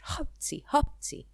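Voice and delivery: two acted sneezes, first one high; mock sneezes. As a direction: fake a sneeze as "hapci". A náthás király tüsszent. (eljátszott tüsszentés)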